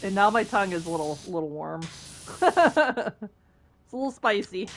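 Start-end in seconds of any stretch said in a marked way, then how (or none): 2.4-4.7 laughing through the words; a slow, amused chuckle as you speak. Real, not acted. Reacting to the warm spice, laughing slowly. Laughing about spicy tongue.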